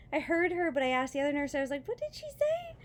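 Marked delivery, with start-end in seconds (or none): 1.9-2.8 very high-pitched puzzled question; incredulous. Retold or imitated. Patient checking with the other nurse, incredulous. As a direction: ask it way up high in disbelief.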